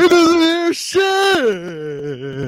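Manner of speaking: very slow, high, drawn-out put-on voice; slow-motion mock voice. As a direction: stretch every word like a lagging stream. Echoing the lag joke.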